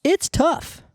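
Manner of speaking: breathless after the gym; panting between phrases; out of breath. Real, not acted. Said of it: "I'm out of breath".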